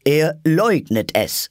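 slow, high, loud pronouncement; loud royal ruling; proclaiming King. Acted. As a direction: announce it to the whole court, stretched out. King proclaims denial to court.

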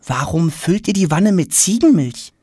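put-on high, bright-eyed boyish questioning; a curious schoolboy firing questions; eager boy Tom. Schoolboy Tom pestering the silent queen with questions.

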